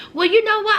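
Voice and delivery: high, loud, sassy comeback; a high, defiant "Well, you know what?"; teen sass. Retold or imitated. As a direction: fire it back with teenage attitude. Pearl snapping back at mom.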